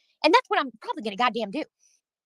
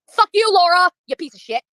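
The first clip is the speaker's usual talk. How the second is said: higher, louder yelled insult; furious shout; 6 semitones higher, 8 dB louder than usual. Real, not acted. Streamer yelling at rival Laura, furious shout. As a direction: let her have it, full volume.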